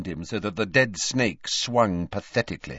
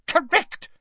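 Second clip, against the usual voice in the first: high, indignant one-word retort; irate, pitched-up confirmation; angry; 4 dB louder than usual. A narrator's reading. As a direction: fire it back crossly. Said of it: "said Ogden angrily".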